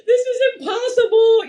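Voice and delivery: high-pitched, half-laughing overwhelmed wail; desperate plea to mom. Retold or imitated. New mom on the phone to her mom, overwhelmed and pleading. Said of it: "I remember calling her and I'm like".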